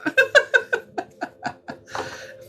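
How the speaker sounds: high breathy giggle. Real, not acted. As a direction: giggle in nervous delight. Laughs at what the cards show.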